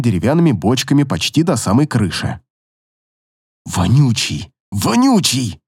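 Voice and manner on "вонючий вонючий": hissed through teeth. Acted. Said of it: "прошипел я сквозь зубы".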